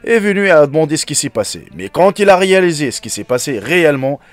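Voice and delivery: steady storyteller read-aloud voice; story narration. Acted. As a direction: read it like a moral tale. Reads a story to viewers.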